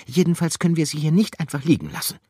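high, fast, firm; decisive. Acted. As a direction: insist on it. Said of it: "antwortete Gruyère entschieden".

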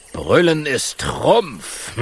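slow, grand lion voice; slow proud lion; lion's motto. Acted. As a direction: proclaim it like a king.

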